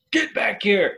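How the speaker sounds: high-pitched parental holler; mock dad yell; parent calling out. Imagined parent calling after kid.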